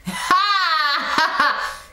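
high, drawn-out mocking laugh; scornful laugh. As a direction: laugh derisively, high-pitched. Scornful laugh at weak prayer.

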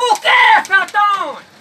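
loud, high-pitched startled cry; startled question. Acted. Digger answers his mate's alarm, startled and loud. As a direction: fire back the question in alarm.

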